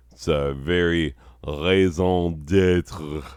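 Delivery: raison d'être said slowly and stiffly, an American mangling the French; fumbled French. Shown as a demonstration. Host botching a French phrase on air, slow and stiff. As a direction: drag out "raison d'être" in a clumsy anglicized way. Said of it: "I don't know how to say the fucking phrase right".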